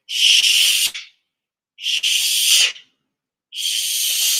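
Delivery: three sharp hissing shh breaths out; forceful shh. Shown as a demonstration. Teacher showing the class the warrior breath, loud shh. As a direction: push the air out hard on each shh. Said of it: "Watch me".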